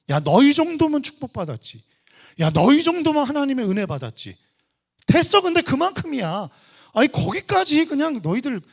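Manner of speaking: loud, high mock scolding; acted high-pitched belittling; dismissive tempter. Satan's belittling words acted out.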